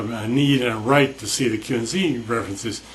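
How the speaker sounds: ordinary delivery, nothing marked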